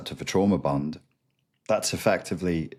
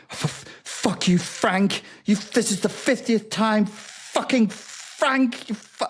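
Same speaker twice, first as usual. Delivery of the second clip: much higher mock distressed rant; imitated upset ex; slower than usual. Victim exploding at ex Frank, mock high-pitched rage. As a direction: squeal it out like a frazzled victim. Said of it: "they're used to you being like".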